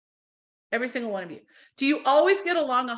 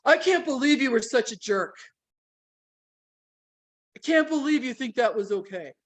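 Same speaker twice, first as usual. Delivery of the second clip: mock angry accusation, a touch harsh; accusing tone. Shown as a demonstration. Demoing the harsh tone with a partner, hurt and accusing. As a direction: pretend to scold a partner for being a jerk. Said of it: "you could hear my tonality going".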